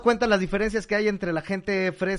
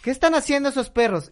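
put-on high, louder kid voice calling mom; imitated child, pitched up; curious little boy; 9 semitones higher, 4 dB louder than usual. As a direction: pester your mother with a child's question. Child questions his mother in joke.

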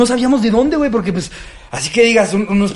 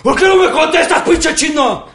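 imitating a furious man in a wheelchair; mock drunk rant; 8 semitones higher than usual. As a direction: rant at the taquero like a raging drunk. Imitating the man yelling insults.

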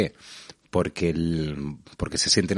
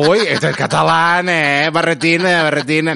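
mocking Madrid listener; 8 semitones higher, 8 dB louder than usual. Teasing a Catalan visitor, loud and high. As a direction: caricature the locals ribbing a Catalan. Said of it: "qué acento catalán tiene".

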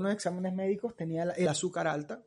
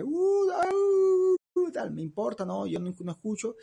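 dismissive mock; 6 semitones higher, slower than usual. People ignoring God, mocked.

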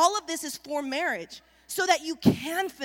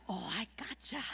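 very soft, low and stealthy; sneaky hush; 6 semitones lower, 12 dB quieter than usual. Acted. Hunter sneaking up on a fawn, stealthy hush. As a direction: say it softly, creeping up on prey. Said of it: "you sneak up on them".

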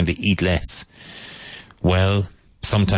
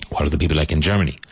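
very quick drill question; much faster than usual. Shown as a demonstration. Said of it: "question drill".

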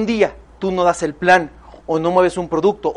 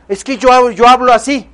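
emphatic speaking; 4 semitones higher, 5 dB louder than usual. Shown as a demonstration. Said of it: "counterexample of how to talk".

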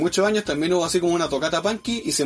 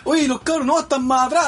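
mock yell; 7 semitones higher than usual. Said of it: "así como gritando".

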